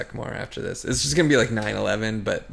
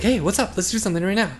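high, louder, bursting with restless energy; hyper dog voice; 10 semitones higher, 4 dB louder, faster than usual. Pretend. Voicing the restless dog to a co-host, hyper. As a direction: pester like an overexcited dog demanding action. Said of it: "Pingua is the opposite".

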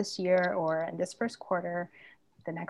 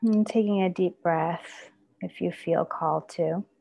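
guided meditation; 3 dB louder than usual. Acted. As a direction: gently guide them into the body. Leads a body-scan meditation.

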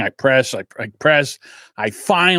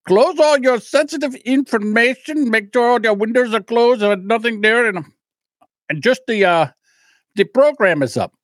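higher, lightly voiced helpdesk guy reading steps; mild mock of a scripted rep; tech support instructions; 8 semitones higher than usual. Comedian imitating the support guy's instructions, higher. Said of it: "So he says".

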